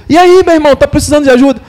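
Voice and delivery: friendly greeting. Retold or imitated. The helper greeting them.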